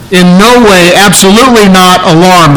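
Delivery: "absolutely" pushed up and out in "In no way, absolutely not alarmed"; emphatic. Shown as a demonstration. Preacher driving home the emphatic negative to the congregation. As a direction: land each negative hard. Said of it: "It means no, absolutely not".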